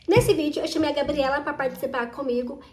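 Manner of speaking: usual speaking voice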